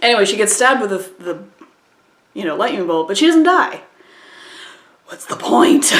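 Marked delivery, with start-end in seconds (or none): 5.0-6.0 laughing. Real, not acted. Mocking the movie, laughing.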